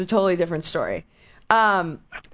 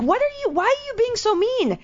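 much higher, pleading indignant protest; retold upset protest; 12 semitones higher than usual. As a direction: demand to know why she's being mean. Calling friend, upset at her.

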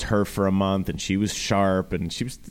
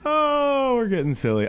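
giggly; slower than usual. Real, not acted. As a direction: still laughing, higher. After laughing fit.

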